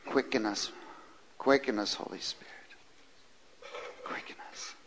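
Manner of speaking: prayer sinking to a whisper; fading prayer. Real, not acted. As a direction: let the plea fade to a hush.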